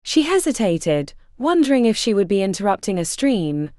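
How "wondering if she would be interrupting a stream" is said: ordinary delivery, nothing marked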